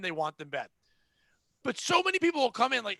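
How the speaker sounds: normal talking voice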